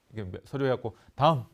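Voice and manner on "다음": brisk call. Shown as a demonstration. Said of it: "이렇게 하잖아요".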